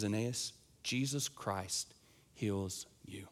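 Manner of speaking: softly and slowly, like a blessing; gentle healing word. Retold or imitated. Peter speaking healing over Aeneas, soft and slow. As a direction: lay the words down gently.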